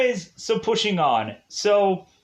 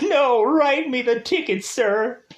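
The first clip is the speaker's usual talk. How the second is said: whiny plea; 7 semitones higher than usual. Retold or imitated. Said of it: "But Allison is like".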